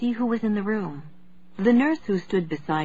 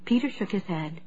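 ordinary delivery

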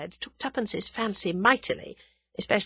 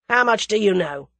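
sudden, louder demand; shot out; 3 semitones higher, 6 dB louder than usual. A narrator's reading. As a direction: fire the question at them. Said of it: "he shot out".

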